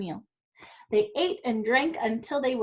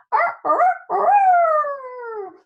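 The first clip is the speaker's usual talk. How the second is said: very high, loud crowing call; rooster crow; 8 dB louder, much slower than usual. Acted. Rooster crows loudly at the end of the day.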